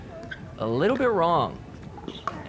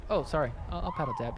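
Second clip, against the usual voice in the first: softer, hesitant imitated apology; mock sheepish apology; 11 dB quieter, much faster than usual. Host voicing player who forgot paddle tap, sheepish. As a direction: drop the volume, imitate a sheepish sorry.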